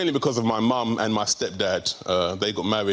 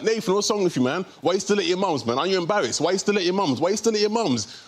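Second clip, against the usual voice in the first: raised-pitch pestering from mates; mates teasing; 8 semitones higher, faster than usual. Retold or imitated. Mates ribbing him for living at home, teasing. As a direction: nag him like his mates, insistently. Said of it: "every time they see me, they're like".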